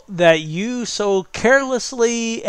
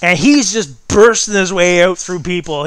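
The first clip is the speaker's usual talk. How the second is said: ordinary talk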